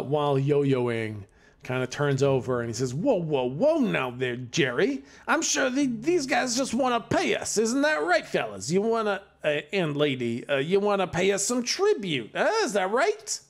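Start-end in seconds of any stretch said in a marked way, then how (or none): 2.9-13.4 high, oily shakedown voice; smarmy thug. Acted. Thin yo-yo boy demands tribute from the party, smarmy thug. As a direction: lean on them for tribute, sleazy.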